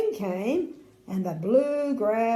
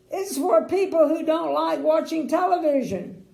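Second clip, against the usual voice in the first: proud, raised inventor's voice; 6 semitones higher, 6 dB louder than usual. A narrator's reading. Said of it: "he explained proudly".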